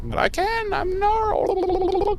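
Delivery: high, squeaky little demon voice; creepy Gnarl voice. Retold or imitated. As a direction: squeak it like a smug tiny demon. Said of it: "he's like".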